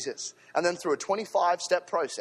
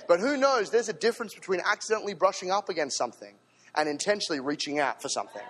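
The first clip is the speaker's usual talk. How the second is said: normal talking voice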